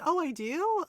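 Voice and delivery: high delighted gasp of surprise; bright surprised question. Real, not acted. Surprised reaction to villager.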